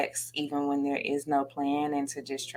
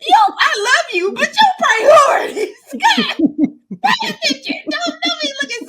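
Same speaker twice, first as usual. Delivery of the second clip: much higher, louder, laughing and shouting; 10 dB louder than usual. Retold or imitated. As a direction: shout it at her through laughter. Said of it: "I'm like".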